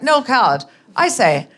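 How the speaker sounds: put-on old-school upper-class English accent; mock vintage posh English gent accent; David Niven. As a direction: imitate a suave English film star, hammed up. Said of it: "my husband becomes David Niven".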